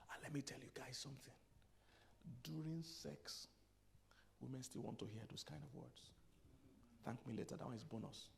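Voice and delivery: hushed tip to the men in the pews; hushed aside. Real, not acted. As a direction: lean in and tell it softly. Confiding a bedroom tip.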